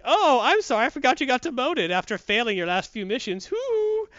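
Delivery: high, loud, gloating sneer; mocking jerk officer. Officer mocks the demoted lieutenant, high and gloating. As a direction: rub her failure in with a fake apology. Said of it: "He's like".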